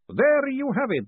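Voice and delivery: put-on high, lively old professor; chatty academic explaining cheerfully; professor's voice. A narrator's reading. Professor chats to Moist, high and lively.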